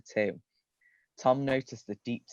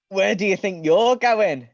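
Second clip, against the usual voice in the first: higher, louder hammed-up villain challenge; Balthazar Dark; 10 semitones higher, 10 dB louder than usual. A narrator's reading. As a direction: loom up behind him menacingly. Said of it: "Balthazar Dark replied".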